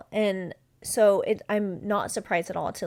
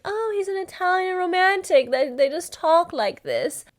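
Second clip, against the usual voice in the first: higher, lightly hammed-up gullible voice; mock naive voice; 8 semitones higher, 4 dB louder than usual. Host imitating her past self excusing the Italian, mock naive. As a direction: sell the excuse like a starry-eyed dater. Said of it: "And I was just like".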